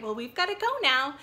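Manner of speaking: ordinary delivery, nothing marked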